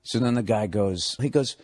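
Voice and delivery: ordinary talk, nothing marked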